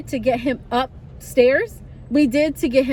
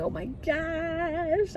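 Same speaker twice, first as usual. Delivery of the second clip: scared gasp; 4 semitones higher, 7 dB quieter, much slower than usual. Retold or imitated. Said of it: "I was scared".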